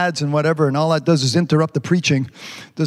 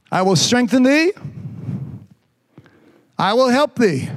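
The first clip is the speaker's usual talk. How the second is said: raised, sermon cadence; preaching; 6 semitones higher than usual. Acted. Pastor preaching to his church, full sermon voice. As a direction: preach it to the congregation.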